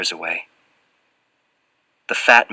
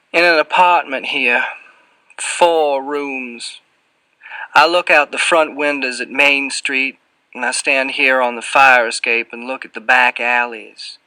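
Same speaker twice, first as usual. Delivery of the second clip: higher-pitched Southern character voice; Southern accent; 5 semitones higher, 3 dB louder than usual. A narrator's reading. Fat man describing his rooms to a visitor, Southern accent. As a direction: speak as an unhurried Southern man. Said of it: "drips with a heavy Southern accent".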